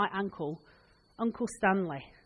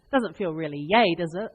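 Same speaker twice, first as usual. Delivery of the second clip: ordinary talk, nothing marked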